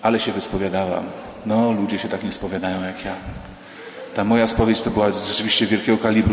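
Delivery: low-pitched, lightly played self-satisfaction; imagined self-satisfied thought. Penitent leaving confession thinks to herself, lightly acted smugness. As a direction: think it to yourself, a touch impressed. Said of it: "w drzwiach pomyślisz".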